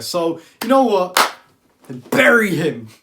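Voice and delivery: slow it down and drive the verdict home; vengeful call. Real, not acted. Urging her viewers against the manager, slow and loud. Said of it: "urging viewers against manager".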